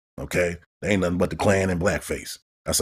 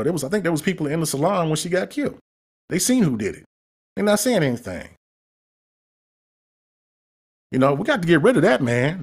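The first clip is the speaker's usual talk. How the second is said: higher, heated rant; real anger; 10 semitones higher than usual. Real, not acted. Podcast host fuming about the girl's killing, angry and high. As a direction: pitch climbing as the anger boils over. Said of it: "I mean, I'm pissed".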